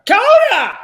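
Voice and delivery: very high, loud imitated shout; mock shout. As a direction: blurt the name out like an announcement. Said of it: "she just shouted out whatever was written".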